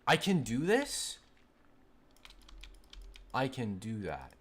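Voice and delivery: loud, high and slow with delight; thrilled discovery. Real, not acted. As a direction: stretch it out in disbelieving glee. Discovering a new game trick.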